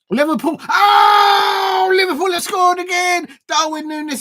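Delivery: high, loud cry of disbelief; excited shout. Real, not acted. Fan crying out as Liverpool score again. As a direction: yell it out as another goal goes in. Live reaction to another goal.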